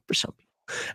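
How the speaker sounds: whispered snarky aside. Shown as a demonstration. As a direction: drop to a whisper for the jab. Whispered aside repeating the remark.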